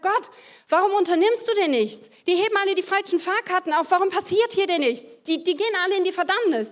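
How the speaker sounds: high, loud, fast upset pleading; agitated outcry. Retold or imitated. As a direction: cry out to God in alarm. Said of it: "ist richtig aufgewühlt geworden".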